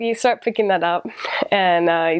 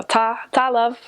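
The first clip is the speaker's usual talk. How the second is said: mock Yorkshire ta; 4 semitones higher, slower than usual. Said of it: "you always say".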